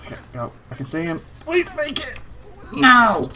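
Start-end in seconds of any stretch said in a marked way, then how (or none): 1.4-2.2 high, anxious plea; pleading. Real, not acted. Urging the character to make jump. 2.7-3.3 loud, high cry of frustration; dismayed shout. Real, not acted. Jump fails.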